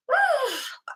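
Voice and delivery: long weary sigh out; exasperated sigh. Real, not acted. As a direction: let out a heavy sigh.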